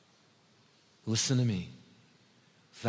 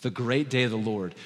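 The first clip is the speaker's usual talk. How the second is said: usual speaking voice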